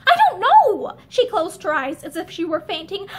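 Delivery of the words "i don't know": anguished cry. Acted. Linda cries out, near fainting.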